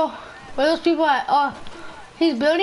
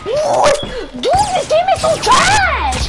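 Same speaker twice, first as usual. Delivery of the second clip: furious gamer shout; raging gamer; 7 semitones higher than usual. Real, not acted. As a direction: yell at the game in rage. Gamer raging after getting lasered.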